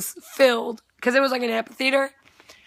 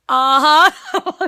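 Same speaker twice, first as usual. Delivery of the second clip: high, louder uh-huh through a laugh; giggly uh-huh; 5 semitones higher, 8 dB louder than usual. Retold or imitated. Starstruck sister admitting the singer is hot, giggling. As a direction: answer while laughing at yourself.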